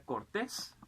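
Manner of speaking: cortés said cor-TÉS; stress on last syllable. Shown as a demonstration. Teacher showing palabras agudas, cortés stressed on TÉS. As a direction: lean on the final syllable of cortés. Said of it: "en la última sílaba".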